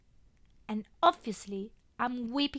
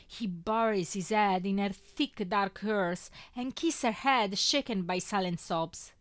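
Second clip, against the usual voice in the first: ordinary delivery, nothing marked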